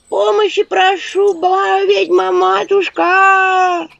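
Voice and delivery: high, slow, syrupy wheedling; butter up the witch with a fawning whine; a sly village woman laying it on thick; honeyed plea. Acted. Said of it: "елейный голос той самой бабы".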